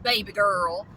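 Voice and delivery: put-on saying "baby girl" in a voice not her own. Shown as a demonstration. Telling off a copycat rescuer, sassy "baby girl". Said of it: "I don't even talk like that".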